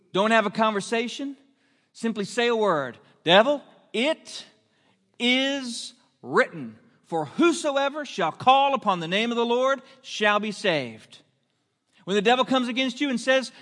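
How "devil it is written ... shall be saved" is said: a slow "it is written", then the verse rings out; fiery preaching. Acted. Preacher declaring scripture against the devil, loud and slow. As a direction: declare the scripture with full conviction.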